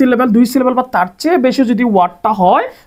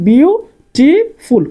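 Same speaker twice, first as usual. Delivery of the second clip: Beautiful drawn out slowly in three separate beats; syllable by syllable; 6 semitones higher, 4 dB louder, much slower than usual. Shown as a demonstration. Teacher asking how many syllables, beautiful in three slow beats. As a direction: break beautiful into three parts with pauses. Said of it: "তিন syllable".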